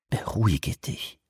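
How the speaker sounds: soft, slow inner voice; inner thought. Acted. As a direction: murmur it to yourself. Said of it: "dachte er".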